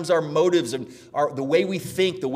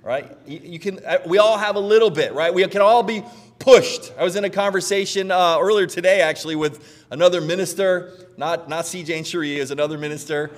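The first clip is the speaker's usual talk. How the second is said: ordinary talk, nothing marked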